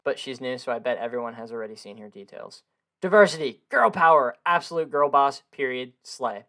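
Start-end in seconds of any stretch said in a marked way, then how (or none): 3.0-6.4 loud, high slogan cheer; mock hype. Gamer cheering a new female character with buzzwords, mock hype. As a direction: shout out buzzwords like a hammed-up cheer.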